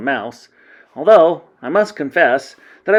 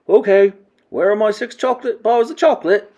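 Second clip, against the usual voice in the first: put-on higher-pitched greedy boy voice; demanding boy; 8 semitones higher, 4 dB louder than usual. A narrator's reading. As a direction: demand your treat like a spoiled child.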